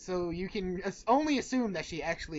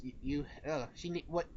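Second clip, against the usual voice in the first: usual speaking voice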